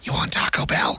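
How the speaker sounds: whispered question in character; acted whisper. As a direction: whisper it like a hushed little request. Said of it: "do our best to act these out".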